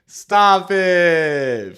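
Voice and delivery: high, loud, fed-up shout; shouted stop it. Real, not acted. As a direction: yell it to shut it all down. Repeats it, more heated.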